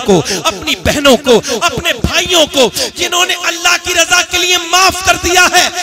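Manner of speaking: loud, high, ringing sermon climax; impassioned preaching. Acted. Preacher to congregation, loud and soaring on forgiveness. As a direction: preach it out to the crowd at full force.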